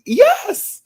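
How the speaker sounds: very high, loud yes; high-pitched exclamation; emphatic agreement. Real, not acted. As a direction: squeal it out in delighted agreement.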